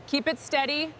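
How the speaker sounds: ordinary talk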